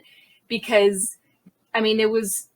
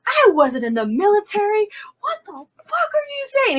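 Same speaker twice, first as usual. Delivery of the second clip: very high, indignant re-enacted yell; yelling back; 12 semitones higher than usual. Officer protesting to her sergeant, high indignant yelling. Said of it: "yelling back at him".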